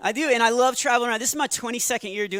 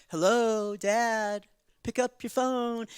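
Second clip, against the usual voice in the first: put-on child's ringtone voice; 2 semitones higher, much slower than usual. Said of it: "his ringtone for me".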